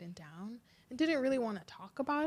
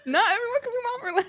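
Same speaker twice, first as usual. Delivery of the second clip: put-on much higher and louder, fast, laughing indignant little boy; mock outraged kid; 12 dB louder, much faster than usual. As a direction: ham up the son's disbelief. Mimicking son's disbelief, laughing.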